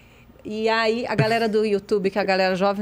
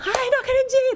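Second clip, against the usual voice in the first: much higher, squealing in disbelief; excited squeal; 13 semitones higher than usual. Retold or imitated. In the dressing room after the show, an excited squeal. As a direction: squeal like a thrilled fan.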